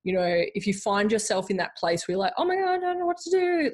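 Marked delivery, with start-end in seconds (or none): 2.3-3.7 high-pitched overwhelmed wail, put on; mock panic. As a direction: imitate someone flustered and helpless, voice way up. Voices someone overwhelmed, not knowing.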